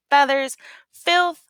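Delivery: ordinary delivery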